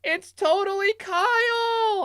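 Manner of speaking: high, slow, stretched-out scream; drunken fan screaming. Retold or imitated. Drunk student at [name] concert, screaming. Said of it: "I started screaming him out".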